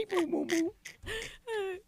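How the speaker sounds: very high squeaky beeps; alien voice. Retold or imitated. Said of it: "That's how they talk".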